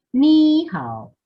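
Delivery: "Ni hao" with "Ni" high and loud; wrong high tune. Shown as a demonstration. Teacher shows how it is not said, high loud "Ni". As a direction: push "Ni" up high and loud, then fall. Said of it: "Fíjense cómo no es".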